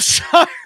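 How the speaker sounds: bursting laugh; high sudden laugh breaking out. Real, not acted. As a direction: laugh cutting into the line. Laughing mid-sentence, high burst.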